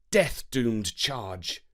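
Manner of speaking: ordinary delivery, nothing marked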